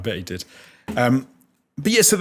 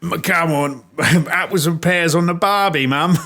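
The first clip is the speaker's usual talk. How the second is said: put-on higher, laughing mixed Cockney-Australian accent; bad Butcher voice; 7 semitones higher, 4 dB louder than usual. Trying Butcher's voice for the co-host, a laughing Cockney-Australian medley. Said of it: "I don't know if I can do Butcher's voice".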